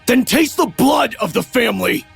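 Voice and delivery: high-pitched, vicious outburst; enraged taunt. A narrator's reading. Brother about to stamp on his sister, enraged. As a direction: hurl it in a frenzy of rage.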